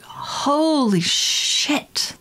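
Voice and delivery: high, very slow amazed holy shit; drawn-out shocked gasp. Retold or imitated. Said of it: "it's like".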